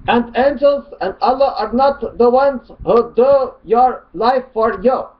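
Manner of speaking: high, loud put-on Arabic accent; mock Arabic accent. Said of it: "Mock Arabic accent here".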